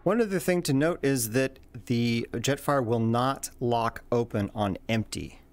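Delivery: ordinary talk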